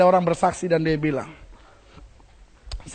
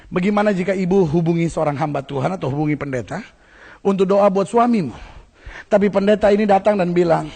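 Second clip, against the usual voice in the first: usual speaking voice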